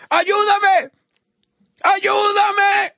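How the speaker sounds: high shouted plea; preacher acting a desperate beggar's call; crying out for help. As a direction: shout for help with a long, high-held cry. Acting blind man's plea for help.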